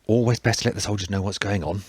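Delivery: ordinary delivery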